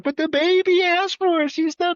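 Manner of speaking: put-on very high, loud baby voice. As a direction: whine it like a little baby. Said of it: "I do the baby voice".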